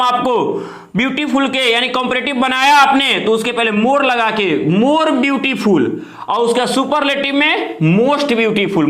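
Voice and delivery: normal talking voice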